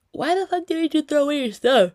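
loud, high furious shout; reenacted outraged outburst; angry yelling. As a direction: yell it accusingly at your brother. Retold yelling at brother on phone.

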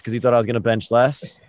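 usual speaking voice

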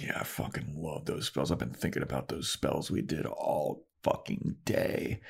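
very soft, hushed Tara; soft conspiratorial hush. Retold or imitated. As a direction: keep it breathy and low, almost secret.

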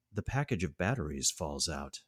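ordinary talk, nothing marked

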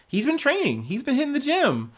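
squeaky high mocking voice for the little overlord. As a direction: pitch way up to belittle him.

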